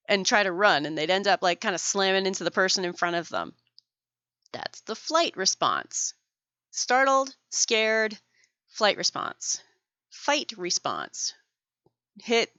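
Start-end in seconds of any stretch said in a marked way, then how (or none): none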